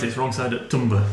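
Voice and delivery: Humber said as "Tumber", a hard T in place of the H; local Tumber. Shown as a demonstration. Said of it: "You say it right".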